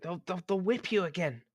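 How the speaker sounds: high, stammering fearful voice; scared servant. Acted. Servant warning the player character, frightened. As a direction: stutter it out in a panic. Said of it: "he's like".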